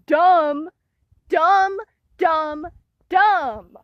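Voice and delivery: loud, repeated angry dumb; frustrated venting. Real, not acted. A girl fuming about getting punished unfairly. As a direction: spit out each dumb. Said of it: "Makes me so mad".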